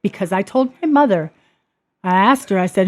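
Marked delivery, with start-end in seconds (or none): none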